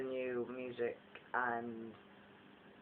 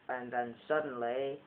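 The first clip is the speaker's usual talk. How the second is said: ordinary delivery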